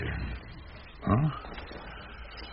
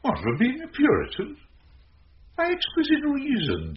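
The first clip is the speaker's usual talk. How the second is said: mock incredulity. Sir Toby mocks Sir Andrew.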